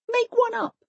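put-on high child's plea; lightly voiced children demanding; children's voice. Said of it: "They always said".

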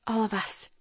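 quiet, discreet instruction; whispered. A narrator's reading. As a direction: keep it under the breath. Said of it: "I whispered".

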